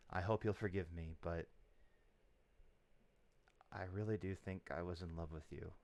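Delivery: low, soft in-character confession of love; hushed and tender, a little hesitant; soft heartfelt confession; quiet confession. Acted.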